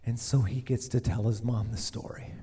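soft unvoiced aside; whisper. Real, not acted. Preacher whispering the story to listeners. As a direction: whisper it to the room. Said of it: "whispered narration".

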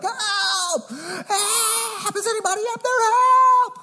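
high, desperate cries for help; panicked yelling for help. Retold or imitated. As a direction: scream up the cliff like a man dangling. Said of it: "So he's just yelling".

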